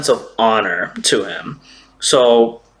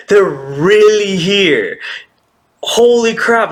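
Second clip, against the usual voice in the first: imitating giddy excitement, higher and drawn out; excited; 6 semitones higher, slower than usual. Yamato lifts Luffy as the Scabbards return, excited. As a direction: marvel slowly, voice lifting in mock thrill. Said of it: "that's how excited she was".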